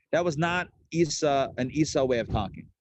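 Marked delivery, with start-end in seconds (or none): none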